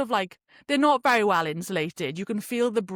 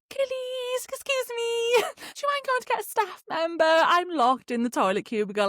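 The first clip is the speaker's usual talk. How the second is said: sweet calling out; 12 semitones higher than usual. Retold or imitated. Calling to girls through door.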